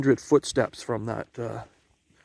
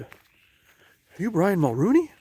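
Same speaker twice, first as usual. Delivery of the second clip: higher, drawn-out mock question; imitating girl passerby; 8 semitones higher, 3 dB louder, slower than usual. Two walkers mistake him for someone else, high imitated question. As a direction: put on a high voice, slowly mimicking the asker. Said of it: "They said".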